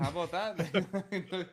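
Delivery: slow sarcastic drawl. Acted. Drawn-out echo.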